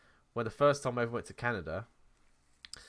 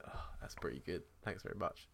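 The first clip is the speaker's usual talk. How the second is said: contented whisper; 3 semitones lower, 9 dB quieter than usual. Retold or imitated. Satisfied with tea in bar.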